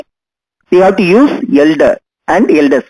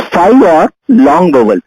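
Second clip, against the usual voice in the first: normal talking voice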